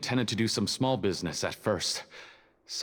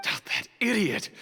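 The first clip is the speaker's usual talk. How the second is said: higher, exasperated curse; angry outburst; 8 semitones higher than usual. Acted. Prisoner cursing the accomplice who confessed, angrily. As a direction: spit out the insult in frustration.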